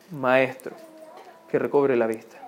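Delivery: low, soft, humble request; quiet plea. Acted. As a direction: ask softly and low, pausing after the address. Blind man's plea read from scripture. (súplica en voz baja y grave)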